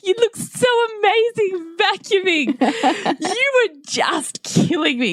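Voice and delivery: high, slow, over-the-top gushing; hammed-up praise. Dad teasing the son vacuuming, hammed-up high gush. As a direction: ham up the compliment like a fan. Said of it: "you ham it up".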